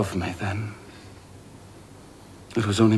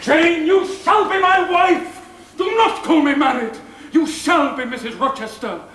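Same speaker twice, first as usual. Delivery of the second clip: fervent insistence; 3 dB louder than usual. Acted. Rochester demands Jane become his wife, loud fervent insistence. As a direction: insist with all your will.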